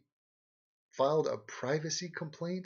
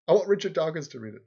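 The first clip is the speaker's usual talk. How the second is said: "want" stressed loud, laughing, fast; laughing emphatic; 5 semitones higher, 6 dB louder, faster than usual. Shown as a demonstration. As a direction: declare it with a laugh. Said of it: "do I want Richard Dawkins".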